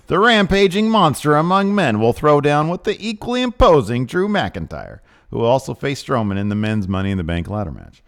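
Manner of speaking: loud, high announcer hype read; hyped wrestling preview read. Acted. Reading the WWE preview to viewers, big announcer hype. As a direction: sell the match like a ringside announcer.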